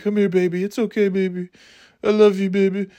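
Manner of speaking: high, cooing mommy voice; mock tender mother. Terrible mother cradles her daughter, mock tender. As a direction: soothe a child in a high pitch.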